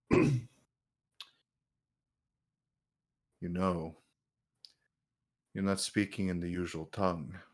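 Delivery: low, very soft reserved reply; loyal knight. Acted. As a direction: answer your lord quietly. Game master voices the knight.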